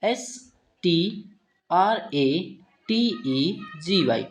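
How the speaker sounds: very slow, letter by letter; spelling out letters. Shown as a demonstration. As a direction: spell each letter out slowly with pauses. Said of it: "word आता है हमारा next". (धीरे-धीरे अक्षर बोलते हुए)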